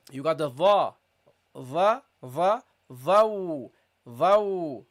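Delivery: va and vau said heavy and deep; teacher drills the heavy letter. Shown as a demonstration. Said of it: "Also heavy".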